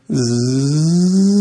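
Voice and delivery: mimicked distant plane buzz; buzzing noise. Pastor imitates a plane coming over the horizon, buzz. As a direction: buzz from far off.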